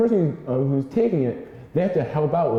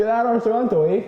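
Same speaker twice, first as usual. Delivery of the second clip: put-on Canadian accent; 8 semitones higher, faster than usual. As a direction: push the Canadian eh at the end. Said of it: "It's Canadian accent".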